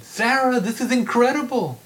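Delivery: very high and loud, nearly shrieking; outraged exclaim. Acted. Professor reeling at the student's denial, shrill outraged exclaiming. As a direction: throw it at her in shock. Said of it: "Newman exclaimed".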